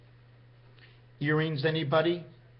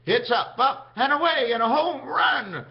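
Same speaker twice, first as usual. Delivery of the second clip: high, loud home-run call; baseball announcer; 8 semitones higher, 6 dB louder than usual. Acted. Speaker imagines announcing a baseball game, excited announcer call. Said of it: "imagine if I was the announcer of a baseball game".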